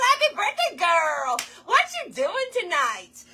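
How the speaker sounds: high-pitched party hollering; high, playful celebratory call; excited birthday cheer. Real, not acted.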